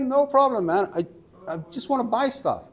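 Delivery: high-pitched defensive pleading; acted exasperated customer; exasperated protest.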